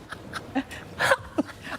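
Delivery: breathless giggling fit; helpless laughter. Real, not acted. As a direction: crack up, unable to speak. Cracks up over tongue-tied words.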